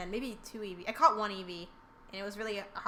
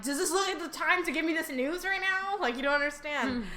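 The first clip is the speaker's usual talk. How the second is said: mock indignant; 7 semitones higher, 5 dB louder than usual. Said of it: "And I'm like".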